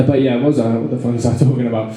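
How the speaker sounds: normal talking voice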